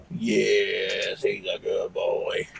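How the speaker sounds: soft, low, slow praise; tender dog praise. Real, not acted. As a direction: murmur fondly to a pet.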